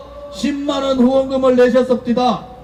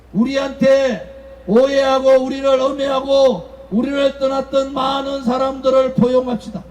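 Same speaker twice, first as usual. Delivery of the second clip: normal talking voice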